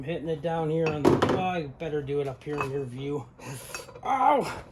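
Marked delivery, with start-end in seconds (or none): 4.1-4.6 sharp yelp of real pain, high; sudden cry after touching something hot; burnt fingertips. Real, not acted. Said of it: "Ah, I burnt my finger".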